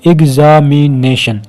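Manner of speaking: Examination slowly, एग-ज़ा-मि-ने-शन; split into syllables. Shown as a demonstration. Teacher counting syllables, examination slowly split as एग-ज़ा-मि-ने-शन. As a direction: sound out each syllable of examination. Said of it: "चार syllable है".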